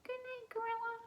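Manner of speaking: high, soft squeaky mouse voice; little mouse. Acted. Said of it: "the little mouse says".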